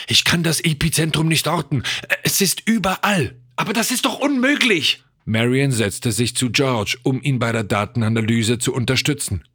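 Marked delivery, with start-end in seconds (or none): 0.1-5.0 rising, high-pitched alarm; alarmed disbelief. A narrator's reading. Scientist cannot locate the epicentre, increasingly alarmed. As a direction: let the panic climb line by line.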